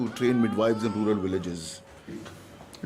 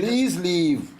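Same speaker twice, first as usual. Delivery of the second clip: louder, raised order to leave; firm dismissal; 5 semitones higher, 4 dB louder than usual. Acted. Doctor ordering the reporter out of his office, louder and raised. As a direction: show him the door.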